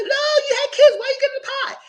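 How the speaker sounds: very high, loud teasing protest; mock outrage at him taking the pie; squealing tease. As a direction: squeal it like a scandalised friend. Teasing the contestant's choice.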